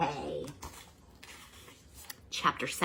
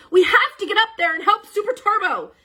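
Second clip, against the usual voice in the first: much higher, louder call; urgent rally; 8 dB louder than usual. Acted. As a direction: push them to help. Urgent rescue.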